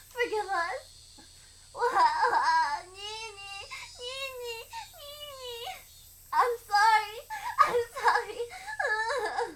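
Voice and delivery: high, tearful calls broken by sobs; a child crying for forgiveness; weeping child begging; sobbing girl. Acted. Satoko sobbing for Nini.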